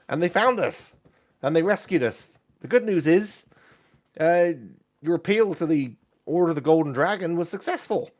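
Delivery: animated companion voice; excitable adventurer Kezik. Acted. Game master plays Kezik.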